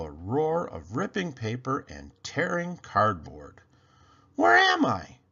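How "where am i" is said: high, lightly voiced little elf, not whispered; startled elf. A narrator's reading. Waking in a dark box, little elf asks high and scared. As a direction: ask it like a frightened little elf. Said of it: "he whispered".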